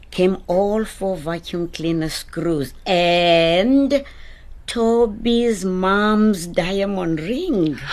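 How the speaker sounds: slow, loud storytelling build-up; dramatic reveal. A narrator's reading. Narrator reveals the found ring, slow and dramatic. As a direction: stretch the words towards the big reveal.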